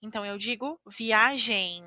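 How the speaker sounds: "viagem" with a nasal end, via-ZHEY; "viagem" ending nasal, no closed lips; final M as nasal. Shown as a demonstration. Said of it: "falamos como se fosse a letra N".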